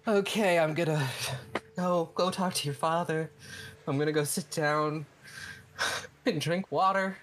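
a lightly voiced son reeling from the shock; stunned and wobbly, needs to sit down; shaken Pythios. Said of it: "Pythios is like".

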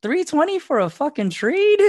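high, loud disbelief; incredulous. Retold or imitated. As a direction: squeal it in shock at the price. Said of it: "but I was like".

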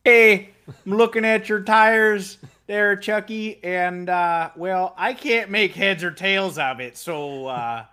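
put-on high, loud, folksy Minnesotan accent. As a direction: play a clueless Minnesotan engineer. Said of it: "doing a Minnesotan accent for an Italian strategist".

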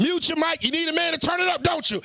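high heated shouting; shouting rant. Real, not acted. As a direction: yell over them. Host shouting down guest.

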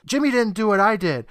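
high-pitched mock scream; retold scream. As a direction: act out a high, urgent interruption. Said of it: "Alan runs in screaming".

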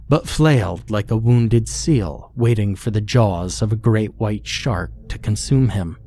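ordinary delivery, nothing marked

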